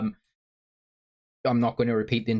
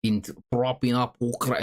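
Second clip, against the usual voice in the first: put-on Zelensky accent. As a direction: put on a deliberate Ukrainian president's accent. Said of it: "please do the Zelensky accent again".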